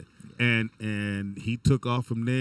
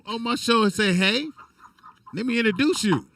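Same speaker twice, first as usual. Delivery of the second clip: indignant outburst; 10 semitones higher, 3 dB louder than usual. Real, not acted. Indignant rant at guest.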